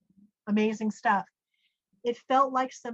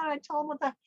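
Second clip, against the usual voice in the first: normal talking voice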